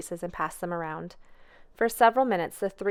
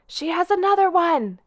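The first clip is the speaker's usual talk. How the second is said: high excited holler from down the street; 9 semitones higher, 7 dB louder than usual. A narrator's reading. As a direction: call it out high and loud with joy. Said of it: "he hollered".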